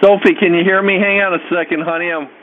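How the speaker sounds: parent on speakerphone. Real, not acted. Talking to daughter on phone.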